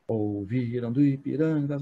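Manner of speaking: slow "Ouviram do Ipirangas" with weak and strong syllables marked; weak strong beats. Shown as a demonstration. Teacher showing the anthem's meter to students, slow stressed beats. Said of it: "ó, fraco e forte".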